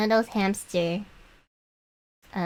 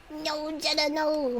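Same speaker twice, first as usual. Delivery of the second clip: high mock plea to Jenna; mock pleading; 8 semitones higher, slower than usual. Fan begging Jenna not to leave, in a high mock plea. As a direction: beg her not to go, pitched up and playful.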